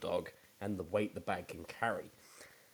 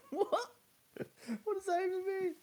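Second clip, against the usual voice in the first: 1 incredulous; faster than usual. Real, not acted. Incredulous at the headline.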